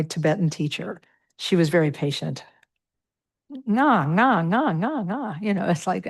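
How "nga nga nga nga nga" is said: "nga" repeated higher and sing-song, the learner trying; imitating her struggle. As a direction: replay the practice session with her teacher. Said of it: "but I wasn't getting it".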